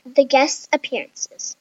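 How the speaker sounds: ordinary delivery, nothing marked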